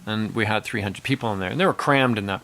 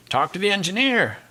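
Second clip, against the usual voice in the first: raised-pitch mock retort; dismissive drummer; 8 semitones higher than usual. Drummer to audience, blaming the engineer, mock retort. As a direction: imitate a performer passing the blame, pitched up. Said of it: "the drummer says".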